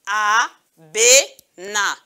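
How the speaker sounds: "abena" very slowly as a, be, na; long pauses between the syllables of "abena". Shown as a demonstration. Teacher reading with pupils, "abena" split into three slow syllables. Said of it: "qu'on a coupé en syllabes".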